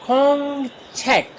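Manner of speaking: slow "खोंग छेक", held high, then falling; syllable by syllable. Shown as a demonstration. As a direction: stretch "खोंग" before landing on "छेक". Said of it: "दो syllable से लफ्ज". ("खोंग छेक" को धीरे, खींचकर)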